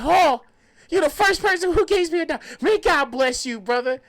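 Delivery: high, loud imitation of a grateful beggar; loud grateful proclamation. Storyteller imitating homeless man praising him, loud proclamation. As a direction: announce your thanks to the whole street.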